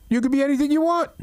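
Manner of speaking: normal talking voice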